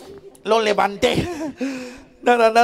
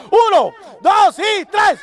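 a loud, higher, slow count to three; loud slow countdown; 9 semitones higher, 5 dB louder, much slower than usual. Acted. Clown counts to three for the whole crowd, loud and slow. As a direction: stretch each number out to build it up.

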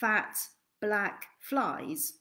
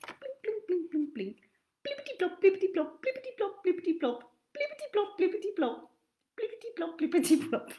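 high, hushed sing-song plopping sounds; 9 semitones higher, faster than usual. A narrator's reading. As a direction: chant the plops like a game. Playful sound chant.

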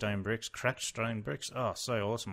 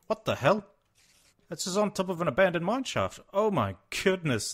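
high, loud disbelief; genuinely stunned by what appears; shocked; 10 semitones higher, 8 dB louder than usual. Real, not acted. Finding an abandoned mine shaft, high shocked exclaim.

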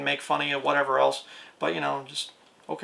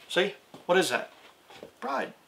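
ordinary talk

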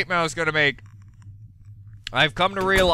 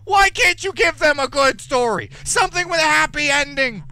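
exasperated outcry; 8 semitones higher than usual. Real, not acted. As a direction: cry out at the unfairness of it. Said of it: "You frickin' went on a tirade".

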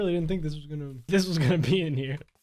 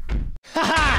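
mock gloating laugh; 9 semitones higher, 6 dB louder than usual. Gloating as the witch.

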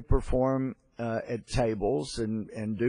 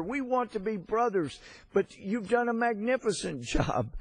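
much higher, gushing tipsy praise with a chuckle; tipsy patriarch praising; 9 semitones higher than usual. Retold or imitated. Drunk patriarch praises singer's city, higher gushing tipsy voice. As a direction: ham up a drunk old man gushing over Kiev, pitched high. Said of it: "having had too much to drink".

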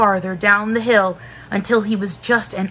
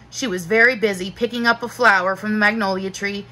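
usual speaking voice

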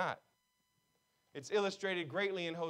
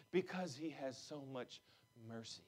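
much softer, low, hushed mercy; soft close; 6 semitones lower, 13 dB quieter than usual. Acted. Pastor closing the message, hushed and soft. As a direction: let the last words fall to a hush.

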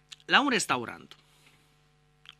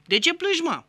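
neighbour asking; 10 semitones higher, 4 dB louder than usual. Acted.

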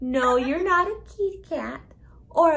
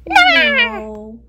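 emphatic no; 10 semitones higher, 11 dB louder than usual. Pretend. Emphatic playful denial.

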